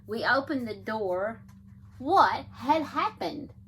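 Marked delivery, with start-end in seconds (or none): 2.0-3.5 slow, loud, high gasping question; dramatic surprise. A narrator's reading. Reading to children, shocked at the opened door. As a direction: let the shock land slowly.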